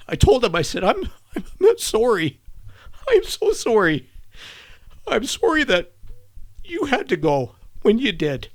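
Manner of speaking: crying through the words, halting, pitch way up; tearful apology. Real, not acted. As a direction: voice breaking high with real grief. Reliving apology at friend's grave.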